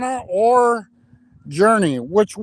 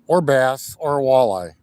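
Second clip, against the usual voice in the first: deep announcer intro; 7 semitones lower than usual. Acted. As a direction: open the show like a deep-voiced outdoors host. Deep show-intro announcer opening.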